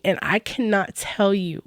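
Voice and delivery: ordinary delivery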